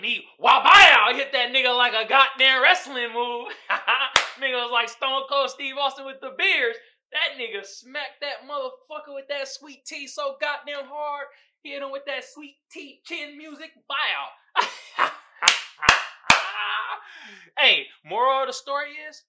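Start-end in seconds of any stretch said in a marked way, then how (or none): 0.0-6.0 loud, high hyped-up retelling; excited. Real, not acted. Vlogger hyping up the iced tea smack, excited shout. 15.1-17.5 loud high cackling laugh. Real, not acted. Vlogger cracking up at his story, loud cackle.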